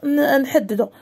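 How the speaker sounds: normal talking voice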